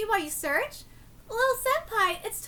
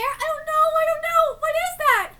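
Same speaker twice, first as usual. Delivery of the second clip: panicked; 9 semitones higher, 6 dB louder than usual. Real, not acted.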